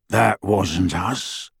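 gruff werewolf. Acted. Werewolf Greyback answers gruffly.

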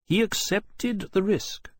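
high, slow character voice; stern officer. A narrator's reading. Captain defending the corps' decision to Lawrence, stern.

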